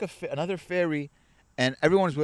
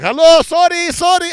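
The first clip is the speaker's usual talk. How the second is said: loud, high mock-apologetic calls; pushy Greek; 13 semitones higher, 12 dB louder than usual. Greeks cutting the ferry line, pushy mock apology. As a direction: call it out high and loud, barging through.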